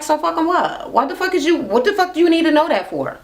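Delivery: hammed-up angry reply; belligerent retort. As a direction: fire it back hot and offended. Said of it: "she was belligerent, angry".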